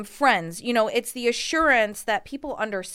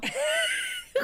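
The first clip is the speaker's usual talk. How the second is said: high burst of laughter; 3 dB quieter than usual. Real, not acted. Laughing at being mimicked.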